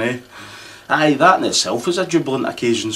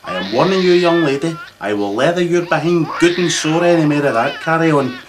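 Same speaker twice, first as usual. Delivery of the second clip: put-on loud, higher scolding threat to a crying child; raised voice telling off a baby; angry scolding; 7 semitones higher, 5 dB louder than usual. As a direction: warn the wee one off, loud and stern. Scolding crying baby while babysitting.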